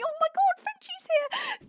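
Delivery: very high, slowed gushing cry; delighted squeal. Real, not acted. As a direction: squeal with joy at a friend arriving. Greeting a favourite viewer.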